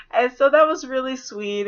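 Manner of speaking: normal talking voice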